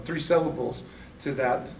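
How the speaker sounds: usual speaking voice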